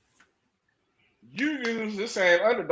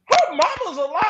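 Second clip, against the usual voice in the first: higher, laughing mock disbelief; hammed-up gasp of shock; retold shock; 9 semitones higher than usual. Retelling the reveal of her mama in the crowd, laughing mock shock. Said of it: "I was like".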